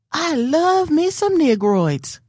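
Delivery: high, slow mock sweet lady voice; lady's voice lifted high, drawn out slowly; quoting the lady. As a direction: imitate the grocery store lady, high and slow. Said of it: "And she said".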